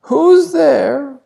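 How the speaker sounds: put-on high, loud old grandma voice; grandma calling. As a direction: call out from bed as grandma. Grandma calls from bed. (grand-mère appelle depuis son lit, voix aiguë)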